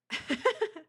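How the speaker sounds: a short high giggle; high laugh. Real, not acted. Laugh after joke.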